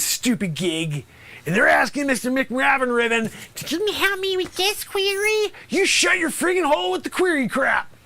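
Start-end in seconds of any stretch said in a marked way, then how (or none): 3.5-5.5 high, whiny imitation of a pupil; mocking student voice. Mimicking the same student again. 5.7-7.9 higher, sharp angry yell; angry outburst. Acted. Angrily snapping at the student.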